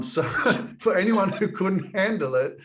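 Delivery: can barely get the words out for laughing; laughing through it. Real, not acted. Laughing about out-drinking others.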